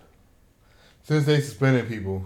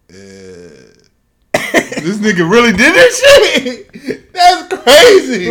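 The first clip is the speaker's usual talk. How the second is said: loud, laughing disbelief, pitched way up; mock outraged laughing yell; 11 semitones higher, 14 dB louder than usual. Mocking the clip, he yells through laughter in disbelief. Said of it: "all I have to do is just like".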